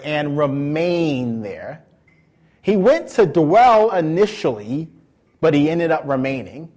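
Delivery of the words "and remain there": slow, drawn-out "remain"; stress on remain. Shown as a demonstration. Preacher having the congregation underline "remain", slowly. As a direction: stretch "remain" for emphasis. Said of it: "underline the next word".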